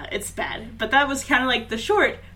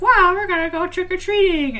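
put-on high, loud gushing kid voice; mock excited kids; 9 semitones higher, 6 dB louder than usual. Reviewer mimicking kids off trick-or-treating, mock excitement. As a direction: gush it like an overexcited child, hammed up.

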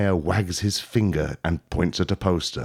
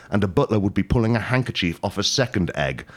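normal talking voice

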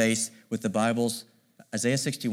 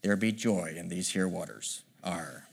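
lightly voiced pirate, low and soft; a hint of a pirate, trailing into an understated "Arr"; pirate speak; 4 semitones lower, 6 dB quieter, slower than usual. Preacher putting the promise in pirate speak, light pirate voice. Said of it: "Let me put it in pirate speak".

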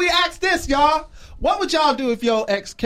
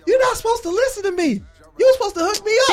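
mock Chelsea; 7 semitones higher, faster than usual. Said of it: "Chelsea gonna say".

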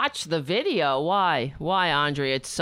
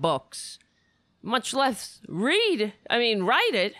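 can't believe anyone writes that much; incredulous amazement; 7 semitones higher than usual. Real, not acted. Marvelling that the author wrote twenty-five books, incredulous and raised.

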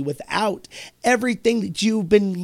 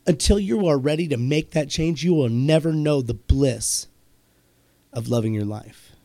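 ordinary talk

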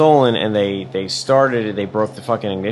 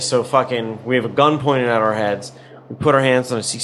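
ordinary delivery

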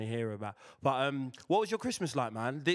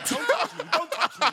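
high, loud mock outrage through laughter; mock protest; 7 dB louder than usual. Man joking about being searched, high loud mock protest. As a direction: squeal it in pretend indignation, louder.

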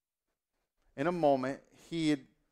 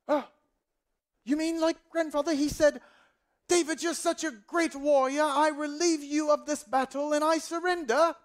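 very high, loud, grandiose grandchild; accented grandson; 13 semitones higher, 5 dB louder than usual. Acted. Grandson guessing at Saul's surrender speech, high declaiming put-on accent. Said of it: "I don't know why they have that accent".

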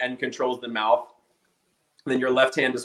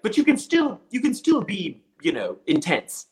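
mock director's note; 9 semitones higher than usual. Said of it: "then he'd be like".

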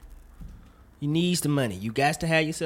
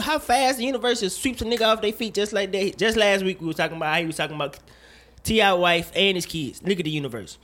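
usual speaking voice

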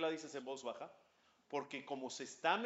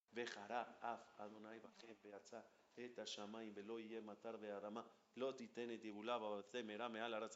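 much softer, lower, hushed recitation; 7 semitones lower, 15 dB quieter than usual. Shown as a demonstration. Rabbi recites the anger verse to his class, hushed. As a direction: drop the voice for God's anger. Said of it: "¿Sabes por qué se baja la voz en esa parte?".

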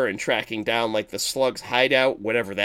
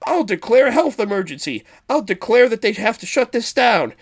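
raised, emphatic declaration; mock threatening; 8 semitones higher, 4 dB louder than usual. Health inspector threatening the sewer boss, high mock ultimatum. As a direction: lay down an ultimatum, pitched up.

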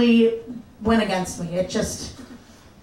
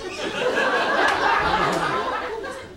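high laugh; 13 semitones higher than usual. Real, not acted. Laughing at her story.

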